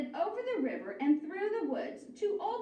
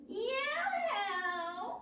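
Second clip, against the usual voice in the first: shouted; 7 semitones higher than usual. A narrator's reading. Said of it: "she shouted".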